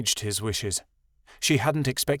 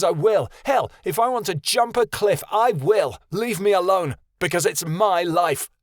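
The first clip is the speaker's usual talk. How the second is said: higher, louder defiant rant; furious tirade; 8 semitones higher, 4 dB louder than usual. A narrator's reading. Son raging at his father to leave him alone. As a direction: throw every line back at him.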